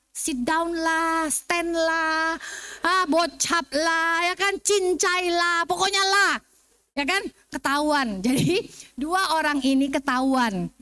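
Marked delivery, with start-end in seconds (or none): 0.1-6.3 very slow Singlish, every lah stretched out; Singlish imitation. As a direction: lay each lah on slowly like a Singaporean. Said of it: "semua pakai la".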